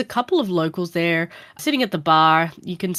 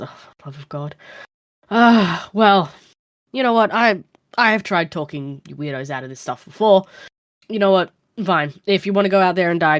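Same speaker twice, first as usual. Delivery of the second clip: fed up, louder outbursts then giving in; exasperated bartender; 5 dB louder than usual. Acted. Bartender gives up on the stubborn stranger, fed up. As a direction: throw up your hands and give in. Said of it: "throws his hands up and goes".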